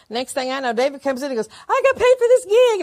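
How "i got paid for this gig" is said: high, loud, thrilled announcement; retold excited shout; excited news. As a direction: burst in bragging about the paycheck. Husband bursts in with news.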